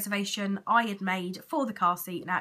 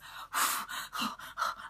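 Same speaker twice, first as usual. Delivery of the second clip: long sigh of relief; 7 dB quieter than usual. Real, not acted. Relief after scan result.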